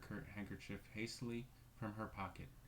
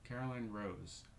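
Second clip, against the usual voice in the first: plain reading voice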